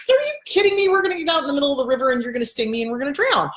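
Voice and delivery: high start, then rushed protest; incredulous toad. Acted. Toad refusing the scorpion a ride, fast incredulous protest.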